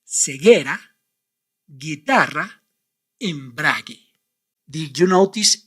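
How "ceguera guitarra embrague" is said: ceguera, guitarra, embrague with silent u; hard g into e or i, no u; Spanish words. Shown as a demonstration. Teacher showing how the words must sound. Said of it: "like this".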